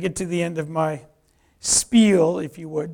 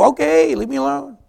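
high, raised-voice grudging surrender; annoyed giving in; 9 semitones higher, 7 dB louder than usual. Retold or imitated. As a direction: give in irritably, voice up. Retold irritated giving in.

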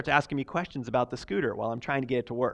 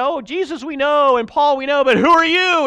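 higher, louder scornful demon taunt; mocking demon; 12 semitones higher, 13 dB louder than usual. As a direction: sneer at the would-be exorcists. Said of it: "They said".

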